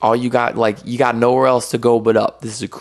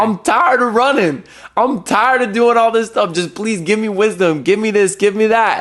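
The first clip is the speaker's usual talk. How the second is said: higher, pleading and worn out; weary plea; 9 semitones higher than usual. Retold or imitated. A believer in his car crying out to God, high and weary. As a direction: beg for rest, tired but pressing. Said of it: "screaming at God in a help me kind of way".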